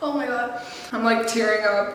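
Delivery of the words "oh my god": coughing, voice strained from choking; speaking through a coughing fit. Real, not acted. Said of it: "That went through the wrong pipe".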